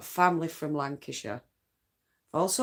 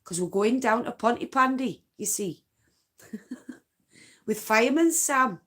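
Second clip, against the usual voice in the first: put-on higher, louder sing-song Welsh accent; 5 semitones higher, 4 dB louder than usual. Knitter doing Fireman Sam to show off a Welsh accent, cheery Welsh accent. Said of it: "Wales. We need Fireman Sam for this".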